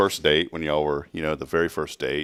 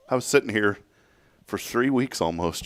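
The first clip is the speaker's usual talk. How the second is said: ordinary talk, nothing marked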